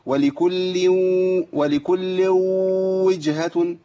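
ولكل وجهة slow with an overdone waw; wrong waw. Shown as a demonstration. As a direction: stretch ولكل وجهة too heavily. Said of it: "في ناس بتقول كده".

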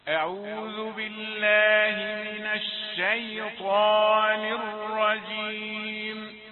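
slow, melodic, sustained chanting; Quranic recitation. Acted. Teacher recites the sura for students to follow, slow chanting. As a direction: chant each verse slowly and let it ring.

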